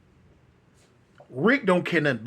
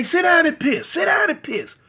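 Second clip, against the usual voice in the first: shouted order; 10 semitones higher than usual. Retold or imitated. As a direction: yell the order at him twice. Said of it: "he say".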